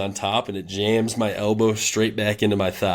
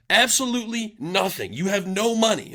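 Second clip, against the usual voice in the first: high, pitched-up imitation of a furious coach; angry coach rant; 12 semitones higher than usual. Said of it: "he was pissed off at the team".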